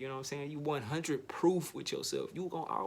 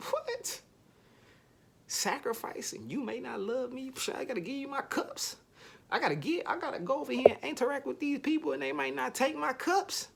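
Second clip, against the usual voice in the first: pitched way up, panicky and whiny; high mock panic; 12 semitones higher, 3 dB louder than usual. Lover panicking over giving up cups, very high whine. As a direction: squeak it out like a spooked partner.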